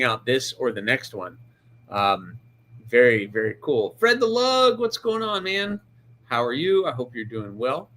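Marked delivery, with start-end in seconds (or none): 4.0-5.8 high, lifted chat greeting; cheery greeting. Real, not acted. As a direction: call out a hello to a viewer. Greeting chat viewer by name.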